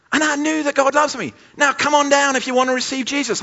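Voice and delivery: high southern US altar call; hammed-up southern US preacher, high-pitched; southern US accent. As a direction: parody an American South evangelist rallying the crowd. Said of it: "had this southern U S accent".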